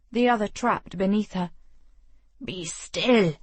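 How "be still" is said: growling. Acted. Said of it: "he growled like an animal".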